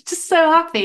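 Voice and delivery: high giggly real laugh through the words; laughing with joy. Real, not acted. Recalling the redundancy email, laughing happily. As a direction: bubble over with delight.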